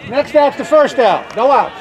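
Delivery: calling the outs. Real, not acted. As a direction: announce it loudly to the team.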